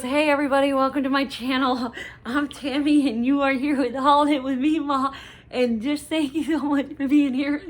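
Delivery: loud, high, bubbly intro greeting; Tammy's intro. Retold or imitated. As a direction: beam a big cheerful welcome to the channel. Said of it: "that was a really bad impression of her".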